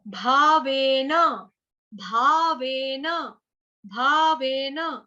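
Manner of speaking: "भावेन" drawn out, with an aspirated भ; slow "भावेन". Shown as a demonstration. Teacher repeats the word slowly for the class. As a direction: drag each syllable.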